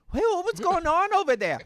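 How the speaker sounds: high-pitched nosy neighbor call; imitated neighbor, high questioning voice; neighbor calling over. Neighbor calling from across street.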